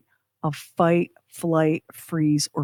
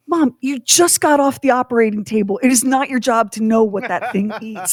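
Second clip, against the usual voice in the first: raised, exasperated plea to mom; exasperated daughter; 5 semitones higher than usual. Retold or imitated.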